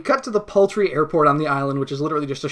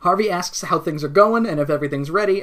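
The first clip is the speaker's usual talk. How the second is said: ordinary talk, nothing marked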